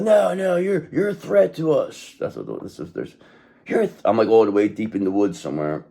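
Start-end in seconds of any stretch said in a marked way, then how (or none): 0.0-2.1 high, drawn-out mock accusation; accusing mob. Mob following a hermit into the woods, high mock accusation. 3.7-4.0 high mock accusation, cut off; accusing mob. Mob calling the hermit a threat, high mock accusation.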